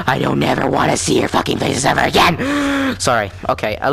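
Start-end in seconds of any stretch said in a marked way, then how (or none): 0.1-2.4 harsh, strained rant at normal pitch; losing it in a raspy rush; angry outburst. Acted. Mock outburst, then gasps and apologises. 2.4-2.9 sharp high gasp for air; sudden audible inhale. Real, not acted. Catches breath after the outburst.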